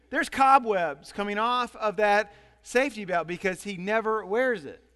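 ordinary talk

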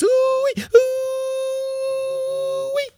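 piercing high "ooh-wee" wail; long shrieked alarm cry, very high; loud wail. A narrator's reading. Woman wailing to raise the alarm, piercing cry. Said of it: "of cries".

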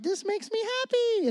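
put-on very high childlike delight over pizza; high squeaky joy. Pastor mimicking himself at the table, squeaky high and gleeful. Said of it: "I'm like".

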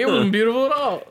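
high-pitched mock defensiveness; retold comic objection; indignant protest. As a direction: argue back high, for laughs. Retold defence against being scolded.

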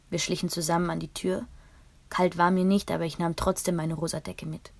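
usual speaking voice

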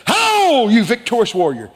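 high, loud startling holler that eases off; booming hail. Acted. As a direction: hail a warrior out of nowhere. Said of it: "Wouldn't you like to have a heart monitor".

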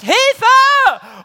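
sudden high shriek of fright; loud shrill yell for help, hammed up for the kids; panicked cry for help. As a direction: scream it like you're terrified and fleeing. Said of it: "wenn Jesus dann plötzlich schreit".

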